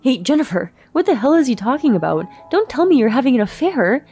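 rising, loud furious demand; high loud accusing yell; angry confrontation. Acted. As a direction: confront your wife about the affair. Husband confronts wife.

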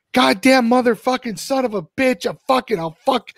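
high, mock furious swearing; retold furious swearing. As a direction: spit out the curses like an enraged man. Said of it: "he was swearing when he got here".

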